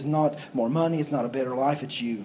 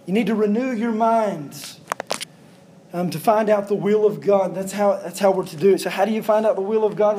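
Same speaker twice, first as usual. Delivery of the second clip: raised, ringing pulpit voice, pressing each question; full-force preaching; 4 semitones higher, 5 dB louder than usual. Acted. Pastor pressing his congregation, preaching at full force.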